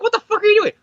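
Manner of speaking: high, loud incredulous yell at the clones; mock outraged yell. Peter yelling at his clones walking out, outraged. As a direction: shout it in shocked disbelief.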